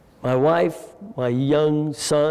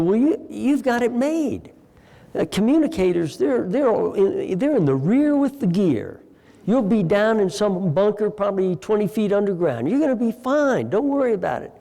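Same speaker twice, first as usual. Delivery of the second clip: 1 reassuring; 7 semitones higher than usual. Retold or imitated. Captain reassuring frightened recruit.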